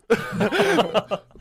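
high, loud laugh; a burst of laughter at himself. Real, not acted. Laughs at bashing parents.

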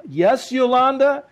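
high, loud, slow drawn-out reply; weary exasperation. Retold or imitated. Tony answering his wife yet again, slow and exasperated. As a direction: drag it out with long-suffering patience.

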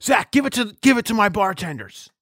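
mock bar-mic yell, pitched high; mimicked yell. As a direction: imitate the man yelling at the coach. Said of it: "Why are we yelling at".

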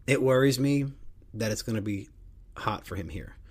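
ordinary talk, nothing marked

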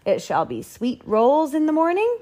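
high, lofty grand lady voice; imperious plummy grande dame. Acted. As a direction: sweep it out like a duchess. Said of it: "said the Duchess".

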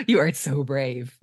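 mock-admiring, low and slow; impressed. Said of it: "Enid is really impressed".

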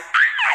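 high, breathy startled shriek; scream. Retold or imitated. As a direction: let out a sudden fright shriek. Girls scared by boys.

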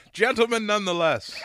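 laughing. Real, not acted. Podcast host laughing at his own gentleman joke, laughing. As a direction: crack up while finishing the joke.

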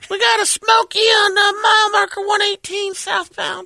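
high, shouted CB call; trucker cb radio. Retold or imitated. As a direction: yell a Smokey warning over the radio. Trucker calling on CB.

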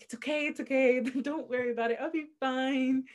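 retold reassurance. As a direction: brush off the apology in a high voice. She reassures the apologizing ex.

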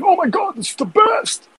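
high squealing delighted fan voice; gushing fan. Retold or imitated. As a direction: squeal it like a thrilled viewer. Donors cheering on cruel content.

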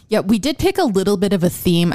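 ordinary talk, nothing marked

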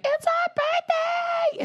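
very high, drawn-out kiddie squeal; happy little girls. Retold or imitated. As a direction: imitate gleeful girls, stretching the words. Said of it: "And they're happy".

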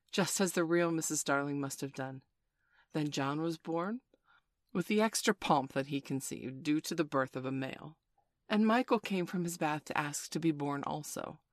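plain reading voice

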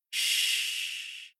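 a soft unvoiced shush; hushing. A narrator's reading. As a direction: hush the room. Hushing listeners in scary story.

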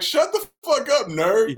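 shut-up yell at a nerd; mock yell. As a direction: bark it at the heckling band. Yelling at heckling band nerds.

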